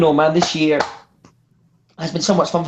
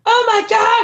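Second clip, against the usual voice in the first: very high mock gasp of shock; hammed-up high exclamation; 3 dB louder than usual. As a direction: imitate a shocked woman, pitched way up. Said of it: "She had this shocked look on her face".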